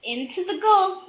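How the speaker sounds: ordinary delivery, nothing marked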